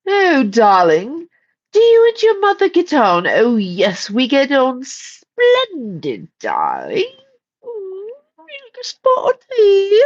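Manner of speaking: high, swooping British darling voice; hammed-up British socialite accent, drawn out; mock British accent. Mock British answer to American phrasing.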